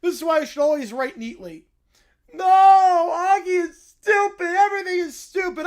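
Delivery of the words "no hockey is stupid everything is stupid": put-on bratty whining boy; whining child. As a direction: whine like a sulking kid. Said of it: "the children continue whining".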